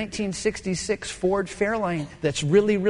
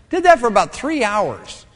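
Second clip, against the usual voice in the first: ordinary talk, nothing marked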